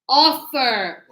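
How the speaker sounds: offer, loud, the of punched; stress on of. Shown as a demonstration. Teacher drilling first-syllable stress in offer for students. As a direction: hit the of in offer. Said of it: "the strength is here at the beginning".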